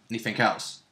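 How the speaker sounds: ordinary delivery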